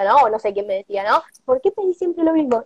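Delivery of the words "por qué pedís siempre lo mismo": angel voice. Retold or imitated. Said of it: "me decía el angelito".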